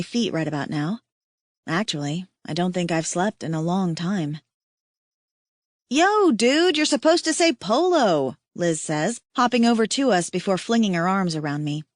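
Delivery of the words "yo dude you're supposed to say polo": high bouncy teasing; playful teasing. Acted. Liz greeting her tired friend, bright teasing. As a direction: tease her like an old friend.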